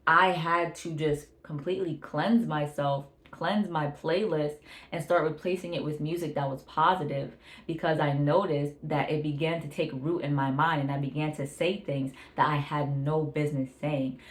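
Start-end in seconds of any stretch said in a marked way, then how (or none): none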